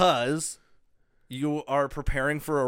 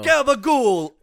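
drawn-out Jersey Italian gabagool, pitched higher; hammed-up Jersey Italian accent; 11 semitones higher, 8 dB louder, slower than usual. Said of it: "the next Tony Soprano".

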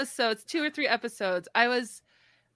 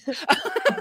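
higher, louder burst of laughter; real laugh; 6 semitones higher, 3 dB louder than usual. Real, not acted. Laughing at her own old-fashioned word, amused laugh.

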